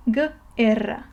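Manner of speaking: "Guerra," split as hard guh, then erra; broken into parts. Shown as a demonstration. Teacher building the word up, "Guerra," in two parts, G then erra. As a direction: separate the hard G from the rest of "Guerra,". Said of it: "then you read the rest of the word".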